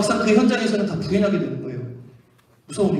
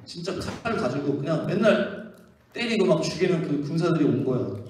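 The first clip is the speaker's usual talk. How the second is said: usual speaking voice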